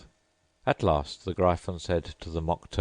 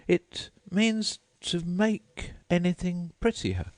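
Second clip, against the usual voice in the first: put-on much higher polite girl voice; Alice's voice; 13 semitones higher, slower than usual. A narrator's reading. Alice thanking the Mock Turtle, high and polite. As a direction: pitch up into a polite young girl.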